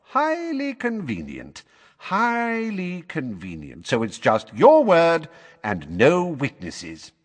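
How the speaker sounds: high, drawn-out mock approval; sceptical official. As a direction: stretch each word slowly in pointed disbelief. Official doubts the boy's account.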